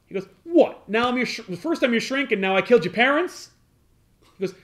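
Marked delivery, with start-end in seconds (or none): 0.4-3.5 higher, louder outraged what; hammed-up disbelief; Nick, accused of killing the parents, higher and outraged; mock indignant. Nick's indignant reply retold.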